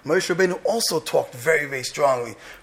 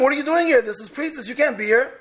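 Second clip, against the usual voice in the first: imagined scream; 9 semitones higher than usual. Pretend. Boaz scolding Rus at night, high angry shout. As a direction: cry out in shocked outrage. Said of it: "He should have screamed".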